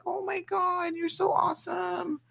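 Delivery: high, soft gushing fan voice. Retold or imitated. Fans gushing over the host, high and soft. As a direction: squeal it like an excited fan. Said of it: "you're always like".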